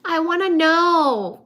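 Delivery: high, loud, drawn-out wail of curiosity; exasperated plea. Pretend. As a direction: beg for the answer, loud and slow. Frustrated at unanswered physics questions.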